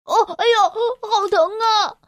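high, slow yelp of pain; cry of pain. A narrator's reading. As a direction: wail as the bite hits. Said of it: "克拉布耶喊道".

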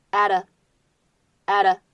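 "at a" as adda, t tapped; linked with flap. Shown as a demonstration. Teacher showing linking plus flap, "at a" as adda. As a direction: join "at a" into one word with a flapped t. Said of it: "可以做一个连读加闪音的处理".

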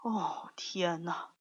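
slow, breath-only exclamation; soft, whispered lion's groan of disbelief; hushed dismay. A narrator's reading. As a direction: whisper it slowly, taken aback. Lion dismayed after being pelted.

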